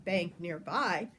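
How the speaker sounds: ordinary delivery, nothing marked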